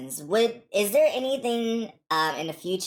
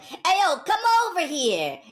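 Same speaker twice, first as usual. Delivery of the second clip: New York accent; 10 semitones higher than usual. Shown as a demonstration. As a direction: call over in a hammed-up New York accent. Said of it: "from a New York accent".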